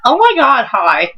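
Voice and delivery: put-on high, chirpy girly greeting; cute girl voice. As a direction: play the sweet-voiced girl on the phone. Mimicking cute drive-thru girl.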